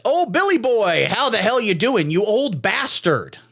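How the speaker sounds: high and slow, drawn out; hammed-up buddy greeting. Tucker greets old Bill O'Reilly, slow hammed-up cheer. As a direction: slap him on the back with it.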